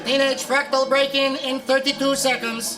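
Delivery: robot countdown. Acted.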